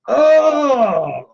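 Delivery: sudden loud pained cry of Oh; a real yelp of pain from a cut. Real, not acted. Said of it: "that really hurts".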